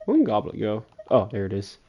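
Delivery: normal talking voice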